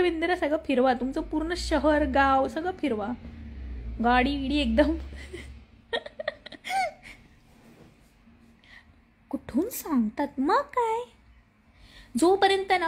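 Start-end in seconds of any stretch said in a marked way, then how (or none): 5.9-6.9 very high, soft giggle; amused giggle. Real, not acted. Host amused at her own list of travel demands, giggling. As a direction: break into a light high laugh.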